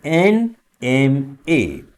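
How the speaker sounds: NMA drawn out slowly, एन joined on; slow model reading. Shown as a demonstration. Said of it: "vowel sound एआई".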